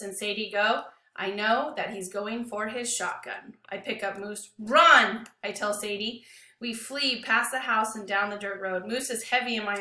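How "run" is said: one loud urgent word; urgent yell. Acted. Boy yelling at Sadie to flee the gun, urgent. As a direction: yell it to get her moving.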